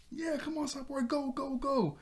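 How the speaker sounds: very high mock cheerleader voice; cheering on. Teammates rooting for Cyborg, imitated high-pitched cheer. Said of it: "they start to cheer on Cyborg".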